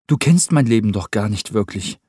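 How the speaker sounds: viel höher, lauter, fröhlich drängend; junger Cameron, munter. Acted. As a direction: heller junger Mann, der Rupert aufmuntert. Cameron voiced by narrator. (hohe, lebhafte Jungenstimme)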